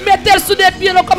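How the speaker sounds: ordinary talk, nothing marked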